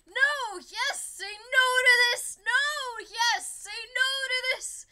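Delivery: loud, high belt, near tears; belted climax. Pretend. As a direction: belt it out until the voice nearly breaks.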